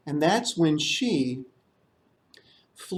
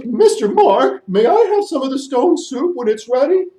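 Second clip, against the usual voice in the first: elephant voice; 7 semitones higher, 6 dB louder than usual. Acted.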